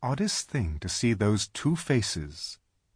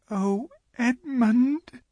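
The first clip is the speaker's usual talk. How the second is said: tearful; much slower than usual. Acted. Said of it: "and began to cry".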